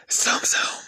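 breathy whispered zoom zoom; whispered jingle. Retold or imitated. Imagined ad team trying the jingle, whispered. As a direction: whisper the slogan like a car ad. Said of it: "we're gonna whisper it".

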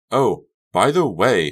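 slow, loud, breezy tone; bright and cheerful. A narrator's reading. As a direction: toss it off brightly. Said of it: "tone was bright and cheerful".

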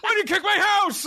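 very high, indignant holler; hammed-up outrage; mock outraged yell. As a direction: imitate an aggrieved owner confronting a culprit. Camper owner confronts the kicker.

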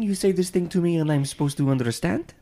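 high-pitched, hammed-up French; mock-indignant Frenchman, pitched right up; French accent. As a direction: echo it in a squeakier French accent. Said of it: "the only accent I can't do".

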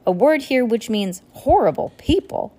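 usual speaking voice

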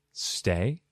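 low, soft stay said to a dog; quiet low dog-training command. Pretend. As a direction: tell a dog to stay, gently. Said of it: "giving you commands like a dog".

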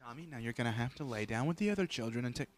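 quick, soft teacher voice; hushed, hurried nap-time instruction; quietly steer an unruly pupil; kindergarten teacher. Acted. Teacher telling Tommy to lie down.

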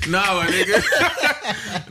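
slow, high laughing denial; brushing off a tease while laughing. Real, not acted. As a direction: laugh it off, drawn out.

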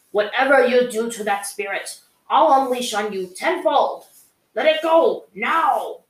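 a hint of a furious uncle's command; aggressive threat. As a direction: threaten him, then snap the order. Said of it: "His voice turned aggressive".

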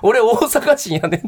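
Tsuda's mock indignation. Imitating his colleague angry at dialect corrections, mock indignation. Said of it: "津田さんずっと怒ってたんですよ".

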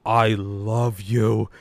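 slow, drawn-out heartfelt thanks. Retold or imitated. To friends who brought pizza, slow heartfelt. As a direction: stretch it out like a grateful friend. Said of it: "you were like".

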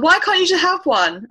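put-on high, loud sulky child voice; huffy child. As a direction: whine it like a put-out little girl. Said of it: "she got really huffy".

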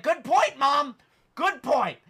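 high, loud mock concession to mom; exasperated sarcasm. Acted sarcastic outburst at mother.

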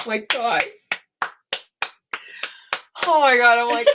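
loud, high burst of emotion; real loud overwhelmed gush. Real, not acted. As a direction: blurt it out, overcome. Overwhelmed after story ending.

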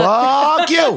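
fuck you blurted high through a laugh; a high, laughing echo of the joke. Real, not acted.

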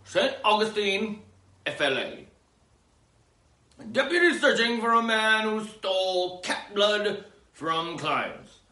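high, punchy newscast delivery; news read. Acted. Host reading the cat blood story, newscaster style. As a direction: announce it like a breaking bulletin.

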